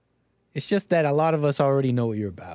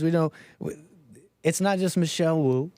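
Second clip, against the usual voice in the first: usual speaking voice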